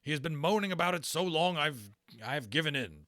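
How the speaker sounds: high, loud exasperated captain; Berkeley's voice. Acted. Berkeley explains to Lawrence, high and loud. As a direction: give in with a grumble. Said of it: "Berkeley said in answer".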